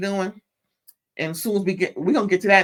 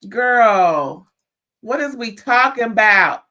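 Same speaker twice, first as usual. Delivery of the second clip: louder, exasperated what is we talking about; 4 semitones higher, 7 dB louder, slower than usual. Real, not acted. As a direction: throw up your hands in disbelief. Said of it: "exasperated outburst".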